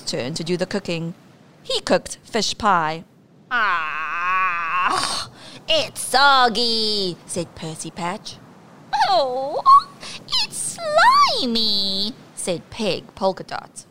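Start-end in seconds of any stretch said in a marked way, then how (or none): 3.5-7.2 disgusted pirate. Acted. 9.1-12.1 high, slow, whining disgust; disgusted moan. Acted. Pirate disgusted.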